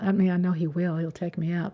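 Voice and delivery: usual speaking voice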